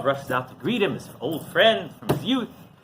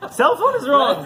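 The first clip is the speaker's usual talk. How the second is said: high, loud parody through laughter; hammed-up stubborn insistence, amused; mock self-righteousness; 10 semitones higher, 5 dB louder than usual. Continues the parody, amid laughter.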